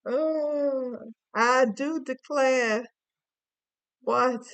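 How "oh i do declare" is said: slow, louder mock Southern belle; southern accent. Reading cards, she mocks a Southern belle in a Southern accent. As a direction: drawl it out in a hammed-up Southern accent. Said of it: "We got some southern, southern accent here".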